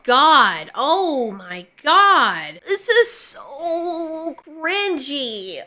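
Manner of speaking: slow, dragged-out groan of secondhand embarrassment; long pained moan at a bad scene; drawn-out cringe. Real, not acted. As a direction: stretch every word like it hurts. Cringing at the clip.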